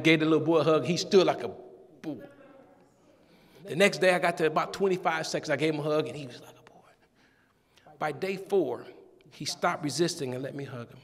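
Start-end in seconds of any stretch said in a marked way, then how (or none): 6.1-7.9 soft, slow whisper. Real, not acted. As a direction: let it fall to a hushed whisper. Tender moment, voice drops.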